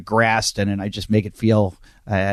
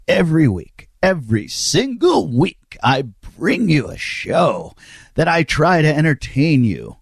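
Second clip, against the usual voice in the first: slow, drawn-out showman announcer; announcer intro; slower than usual. Pretend. Host welcoming podcast listeners to the show, hammed-up announcer. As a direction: stretch the words like a ring announcer.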